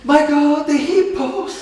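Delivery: loud high dismayed outcry, slowing on the hippos; mock horror at the ruined harvest; shocked cry. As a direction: cry out in disbelief.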